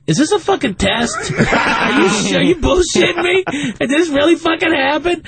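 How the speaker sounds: high-pitched disbelief, voicing a drug dog; incredulous dog. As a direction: play a dog asking its handler in mock astonishment. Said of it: "dog looks back at the handler like".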